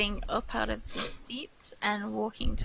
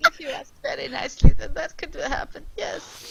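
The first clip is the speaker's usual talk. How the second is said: much higher, louder, camp and quick; flamboyant tiefling host; 15 dB louder, faster than usual. Acted. Tiefling courtesan greets the party who bought his evening, camp and high. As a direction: purr it like a showy courtesan greeting clients. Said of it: "that's the wrong accent".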